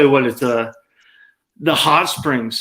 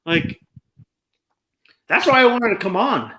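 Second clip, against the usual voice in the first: usual speaking voice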